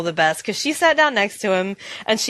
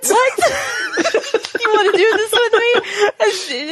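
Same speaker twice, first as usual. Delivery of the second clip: high squeaky mock quote broken by laughter; laughing mock quote; 12 semitones higher than usual. Retelling the awkward presenter's invite, laughing. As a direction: laugh through the presenter's line.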